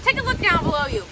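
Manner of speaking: a hint of a live field reporter; mock on-the-scene news report. Kid plays live field reporter.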